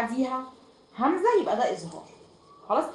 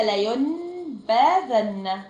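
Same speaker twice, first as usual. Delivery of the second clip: لا ينبذن spelled out slowly; slow and careful, for writing down; slow dictation; 3 dB louder, much slower than usual. Shown as a demonstration. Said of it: "اكتبي كده".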